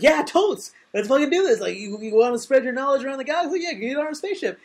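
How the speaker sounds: loud, high, rapid gushing; hammed-up overexcited dude; eager agreement. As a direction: gush it fast at the top of your range. Acting out Cybok's eager agreement.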